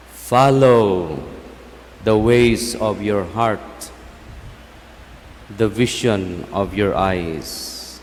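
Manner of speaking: proclaiming scripture with fervor; preaching. Acted. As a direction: proclaim the verse, then ease off. Priest quotes scripture in sermon.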